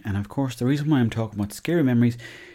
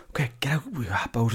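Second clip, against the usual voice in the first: hushed unvoiced whisper, sneaking; 4 semitones higher, 3 dB quieter than usual. Boy sneaking out with cousins, whispering. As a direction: whisper as if someone might hear.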